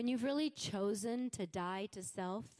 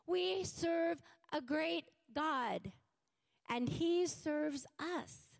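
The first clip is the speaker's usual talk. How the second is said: slow emphatic preaching; 4 semitones higher, much slower than usual. Real, not acted.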